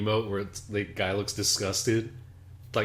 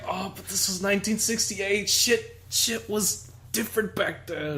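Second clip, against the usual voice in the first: high mock excuse; 9 semitones higher, slower than usual. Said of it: "It's like".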